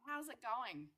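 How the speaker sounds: muffled, far-off headset audio; like talking from underwater; underwater sound. Real, not acted. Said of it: "You sound like you're underwater".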